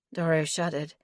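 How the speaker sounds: ordinary delivery, nothing marked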